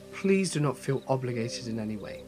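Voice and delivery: ordinary delivery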